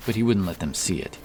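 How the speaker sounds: plain reading voice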